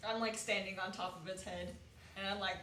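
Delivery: ordinary delivery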